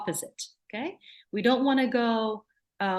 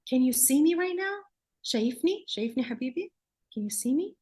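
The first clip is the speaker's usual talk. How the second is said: gentle, higher sing-song check-in; gentle grounding voice; 6 semitones higher, much faster than usual. Shown as a demonstration. Therapist gently calling a frozen child back. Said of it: "So I may say something like".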